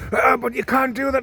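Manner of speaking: high, whining mock protest; whiny assistant mocked. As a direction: whine it like a flustered woman.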